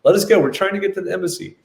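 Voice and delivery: high and fast, begging to be let through; urgent pleading. Retold or imitated. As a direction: rush it out, desperate to reach the embassy. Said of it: "we're like".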